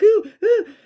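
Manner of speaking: very high, loud squeezed ooh cries; uncomfortable ooh. Retold or imitated. Describing the squeezing feeling, high loud oohs. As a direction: squeal as if squeezed tight. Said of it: "I was like".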